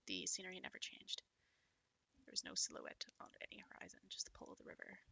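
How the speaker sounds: whispered game narration; soft story reading. Acted. Reading the game text aloud in a whisper. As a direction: read it hushed and eerie.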